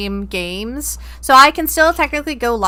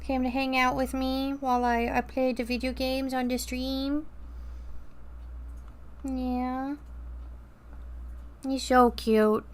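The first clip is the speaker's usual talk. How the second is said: soft pet talk; 13 dB quieter, slower than usual. Real, not acted. Talking to pet softly.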